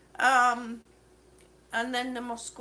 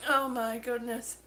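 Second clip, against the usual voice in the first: usual speaking voice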